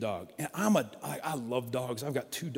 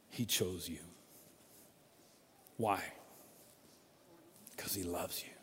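tender reassurance; 10 semitones lower, 14 dB quieter than usual. Real, not acted. Reassuring the congregation.

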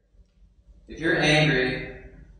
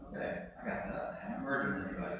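muttered half to yourself, much softer, fast; a soft, quick mumble of relief; mock quiet aside; 5 semitones lower, 17 dB quieter, much faster than usual. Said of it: "And you go".

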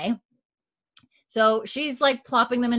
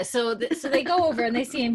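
ordinary delivery

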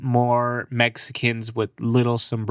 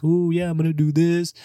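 mock excited grad; 6 semitones higher than usual.

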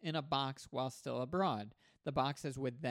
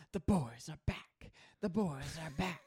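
sultry narrator. Pretend. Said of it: "in a sultry voice at the end?".

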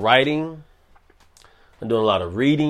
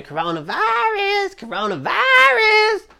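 squealed sing-song shriek, far higher. Retold or imitated. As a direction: shriek it like the viral clip. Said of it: "where she's screaming".

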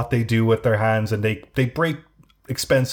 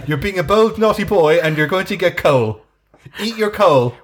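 mock British nanny; 5 semitones higher, 4 dB louder than usual. Keeps scolding in nanny bit.

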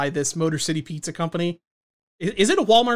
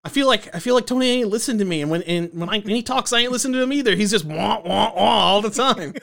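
higher, whining mobster impression; Christopher; 4 semitones higher than usual. Christopher griping that Tony ain't listening, mock complaint. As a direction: complain in a put-on voice, hammed up. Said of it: "Christopher's like".

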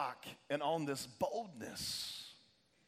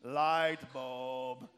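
low cartoon villain voice; low, put-on character voice; Gru impression; 5 semitones lower than usual. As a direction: drop low and imitate a cartoon villain's realization. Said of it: "Gru from Despicable Me".

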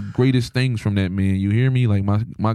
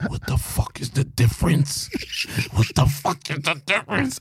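pondering; 2 semitones higher than usual. Retold or imitated.

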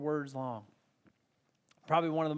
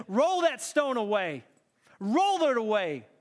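raised, forceful order; authoritative command; 4 semitones higher, 4 dB louder than usual. Retold or imitated. Jesus at the tomb with all authority, commanding. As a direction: command it loudly, pitch up. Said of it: "With all authority in heaven".

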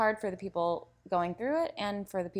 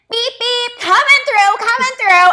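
very high, very loud honking shout; full-volume comic yell, pitched way up; imitated calling out; 19 dB louder than usual. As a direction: holler like a human car horn clearing a path. Imitating woman clearing the way loudly.

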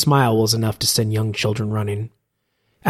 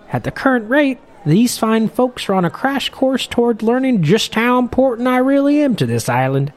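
put-on much higher, louder old sailor voice; a chatty old sea captain, pitched way up; old fisherman; 10 semitones higher, 3 dB louder than usual. Old captain grumbling to Morwell at the bar, in a high loud old-man voice.

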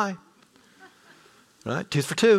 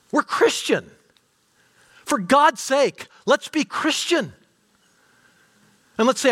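raised, high, pressing sermon voice; fervent preacher pleading with his flock; preaching at full force; 10 semitones higher, 4 dB louder than usual. Acted. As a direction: preach it hard to the room.

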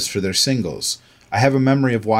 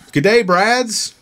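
booming, drawn-out "Good day, Brads" in an Aussie accent; loud Aussie greeting; 11 semitones higher, 4 dB louder, slower than usual. Shown as a demonstration. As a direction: belt out the "Good day" like a hearty Australian. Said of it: "I'm sorry, I couldn't hear you".

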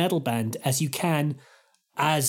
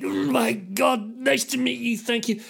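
strained politeness; 6 semitones higher than usual. Retold or imitated. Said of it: "I'm like".

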